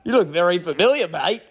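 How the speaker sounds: a clumsy try at an Aussie runner's Australian voice; bad Australian accent. Runner recognizing him at the track, in a weak Australian accent. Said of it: "I don't have a great Australian accent".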